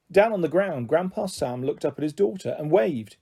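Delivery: usual speaking voice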